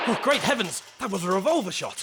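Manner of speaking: alarmed exclamation. Acted. Gunshot heard, Watson alarmed.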